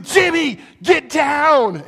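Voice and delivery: loud, high yell of a father warning his son; urgent yell. Retold or imitated. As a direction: shout it like the snake is right there. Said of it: "He's like".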